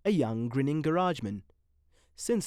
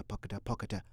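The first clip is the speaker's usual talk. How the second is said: plain reading voice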